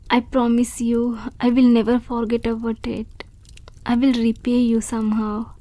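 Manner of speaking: ordinary talk, nothing marked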